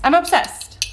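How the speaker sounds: squealing I'm obsessed in delight; excited gush. Real, not acted. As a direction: gush over the jar, voice lifting. Repeats obsession about yogurt jar.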